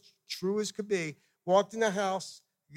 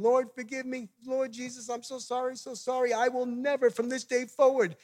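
higher-pitched imploring re-enactment; retold tearful repentance; 6 semitones higher than usual. Missionary on his knees repenting to God, re-enacted tearful pleading. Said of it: "I start to cry ... I start repenting".

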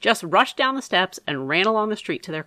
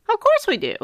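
lightly voiced jovial uncle, higher; a hint of a playful uncle, pitched up; Uncle Harvey; 3 dB louder than usual. A narrator's reading. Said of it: "said Uncle Harvey".

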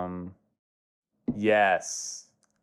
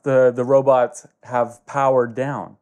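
ordinary delivery

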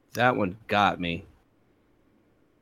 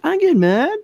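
much higher, louder mock insistence; Jerry insisting; 4 dB louder than usual. Retelling a sitcom, Jerry protesting he gets mad, high and indignant. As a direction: squeak it out like an indignant sitcom guy. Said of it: "And he was like".

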